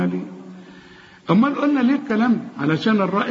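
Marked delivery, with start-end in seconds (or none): none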